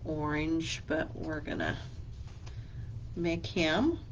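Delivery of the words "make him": normal talking voice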